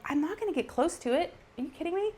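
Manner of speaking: as if he's crazy for asking; incredulous retort. Retold or imitated. Wife to husband about the photo, high incredulous retort. Defends far-away photo.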